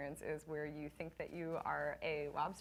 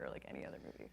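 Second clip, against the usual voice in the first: hushed aside under the breath; whispering; 11 dB quieter, much faster than usual. Real, not acted. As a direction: drop to a whisper for the joke. Sudden hushed aside to audience.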